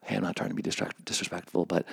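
hushed placating. Retold or imitated. Helper excuses self to director on stage, quick and hushed. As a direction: imitate a discreet, careful protest.

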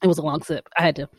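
just swallowed a drink; speaking right after sipping; after a sip. Real, not acted. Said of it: "Let me take a sip before I say it".

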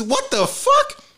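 high, drawn-out incredulous cry; mock-outraged squeal of bewilderment; baffled outburst. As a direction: react in stunned disbelief, pitch shooting up. Acting his bewildered child self.

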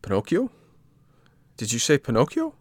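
high, astonished echo of the name; surprised puppet. A narrator's reading. Puppet hearing his own name, astonished. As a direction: repeat the name in disbelief.

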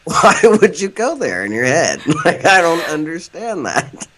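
baffled, half-laughing; incredulous. Retold or imitated. Acting her own baffled reaction.

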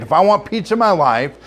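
usual speaking voice

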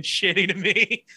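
usual speaking voice

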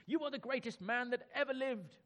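high, fawning praise for the king; flattering courtier. Acted. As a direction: gush over him like a flatterer.

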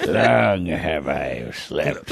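slow mock mummy voice; dusty mummy rasp. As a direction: drag it out like a waking mummy. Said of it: "impression of a fake mummy".

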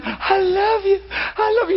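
high, swooning adoration; gushing worshipper. Retold or imitated. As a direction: coo it like the praying ladies. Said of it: "ladies worshiping".

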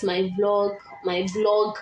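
normal talking voice

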